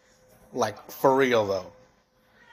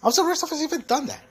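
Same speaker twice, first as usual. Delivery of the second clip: high incredulous squeak; faster than usual. Real, not acted. Sudden high-pitched exclaim.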